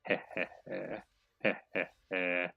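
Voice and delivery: low quiet villain chuckle; evil chuckle. Acted. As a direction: chuckle darkly under the breath. Gloating over opponent's attack.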